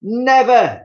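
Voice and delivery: wife refusing to believe it; Missus Twit crying out. Acted. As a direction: cry it out in horror. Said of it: "she cried".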